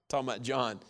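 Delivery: normal talking voice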